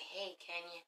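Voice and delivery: hushed whisper. Retold or imitated. Whispering Matt's greeting to Kenya, hushed. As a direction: whisper it low and soft. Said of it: "He's like".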